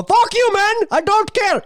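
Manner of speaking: high, loud mock yell. Retelling a driver's road rage, high mock yell. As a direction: yell it at the driver you cut off. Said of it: "give you a finger, and go".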